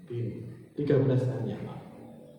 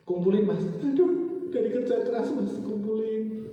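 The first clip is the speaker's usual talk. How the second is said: acted protest; 8 semitones higher than usual. Voices protest over hard-earned savings.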